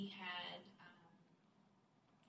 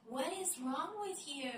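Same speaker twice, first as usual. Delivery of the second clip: much higher, mock-alarmed question; alarmed husband; 9 semitones higher, 3 dB louder than usual. Husband finding his wife weeping, higher mock alarm. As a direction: retell the husband's startled worry. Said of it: "he's like".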